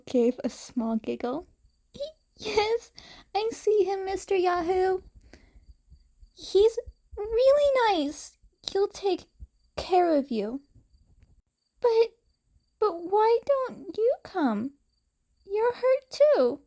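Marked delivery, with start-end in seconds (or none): 2.0-10.6 put-on high, eager child who wants to believe it; a small girl pointing him out, much higher; little girl voice. Eri telling Izuku the hobo is nice, high child voice. 11.8-16.6 put-on a small girl who doesn't want to be left; worried little girl. Eri begging Izuku to come too, high worried child.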